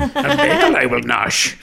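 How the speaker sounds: Yiddish Yoda. Pretend. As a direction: Yoda word order with a Yiddish lilt. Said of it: "the movie Mensch talking".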